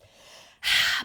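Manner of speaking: big loud sigh through the mouth; forceful sigh. Shown as a demonstration. Teacher exhaling with the class, loud forceful sigh. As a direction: push the breath out hard.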